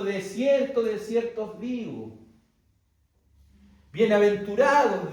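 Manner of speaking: loud, solemn pulpit proclamation; sermon voice quoting Christ aloud; proclaiming words of Christ. Retold or imitated. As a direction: declare it to the congregation. Preacher quotes Christ proclaiming.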